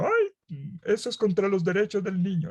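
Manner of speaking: high, hammed-up mother's wail; mock a fussy mother. Preacher mocking a mother who fears any scolding, hammed-up and high.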